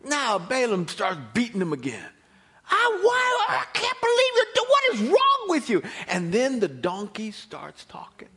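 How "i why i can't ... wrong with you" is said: high, loud, laughing exasperation; frustrated rider. Acted. Balaam yelling at the donkey lying in the road, exasperated high shout. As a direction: yell at the stubborn animal in disbelief.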